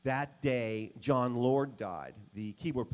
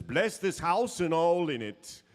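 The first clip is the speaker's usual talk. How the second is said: grand blessing; 4 semitones higher, 6 dB louder, slower than usual. Retold or imitated. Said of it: "he says".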